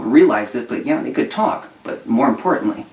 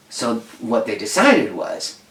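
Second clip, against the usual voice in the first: normal talking voice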